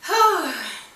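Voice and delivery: a loud, high heavy sigh before answering. Real, not acted. Asked how long she has left in the program, a loud heavy sigh. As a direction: let out one big sigh before you answer.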